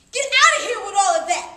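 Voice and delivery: loud, high, quick dismissal; defiant outburst. Acted. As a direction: throw the insults off with a sudden shout. Shaking off the whispered insults.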